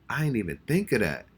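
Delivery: ordinary talk, nothing marked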